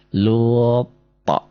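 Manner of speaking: "Luto" with a bounced, echoing consonant; qalqalah. Shown as a demonstration. Teacher demonstrating for students, bounced qalqalah. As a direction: hold the first syllable of "Luto", then pop the last. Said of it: "Nah, ini qalqalah ya".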